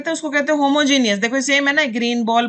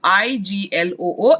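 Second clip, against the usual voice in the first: slow, deliberate letter-by-letter spelling; slow classroom spelling; 3 semitones lower, much slower than usual. Shown as a demonstration. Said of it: "इसे हम कहते हैं इग्लू".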